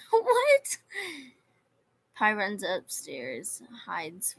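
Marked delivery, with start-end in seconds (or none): 0.1-0.8 high squeaky shocked what; loud, high disbelieving what?. Real, not acted. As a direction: jump up in pitch, stunned. Shocked reaction to chat.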